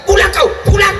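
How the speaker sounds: high loud order to come home, hammed up; mock angry wife. Wife ordering husband home, mock shout. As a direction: yell it like a nagging wife.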